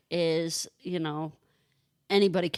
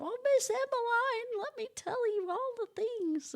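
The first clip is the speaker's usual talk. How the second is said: high child voice, imitating the kids; 3 dB quieter than usual. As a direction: pitch way up like an eager Southern kid. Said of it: "They run and hug me".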